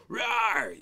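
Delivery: put-on high playful roar for the kids; bear roar. Shown as a demonstration. Dad shows the kids when to roar, playful roar. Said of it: "And then you go".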